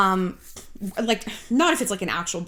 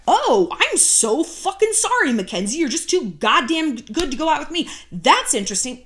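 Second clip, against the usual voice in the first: sarcastic outburst; 5 semitones higher, 3 dB louder than usual. Acted. Rejected man lashing out at Mackenzie, bitterly sarcastic.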